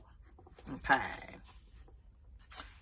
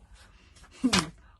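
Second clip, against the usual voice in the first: scornful laugh; 12 dB louder than usual. A narrator's reading.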